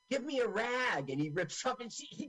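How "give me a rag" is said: acted demand. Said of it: "so he goes".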